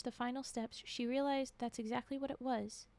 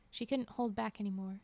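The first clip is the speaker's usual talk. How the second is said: plain reading voice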